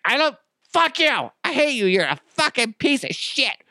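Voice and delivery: very high mock-hysterical tirade; pissed-off girlfriend. Stomach as girlfriend yelling at cheese, high mock fury. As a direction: scream abuse as an over-the-top ex. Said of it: "the pissed off girlfriend".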